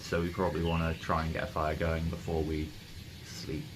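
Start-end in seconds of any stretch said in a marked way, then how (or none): none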